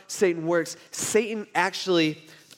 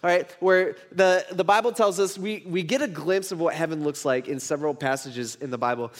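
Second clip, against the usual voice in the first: ordinary delivery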